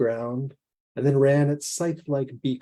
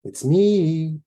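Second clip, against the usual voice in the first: louder, lilting sing-song answer; 4 semitones higher, 5 dB louder than usual. Acted. Strange visitor answering through the door, sing-song. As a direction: let the pitch rise and fall playfully. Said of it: "rose and fell in sing-song inflection".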